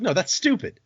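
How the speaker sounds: high, impatient retort; exasperated insistence. Acted. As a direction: insist as if it were obvious. God insists on his clue.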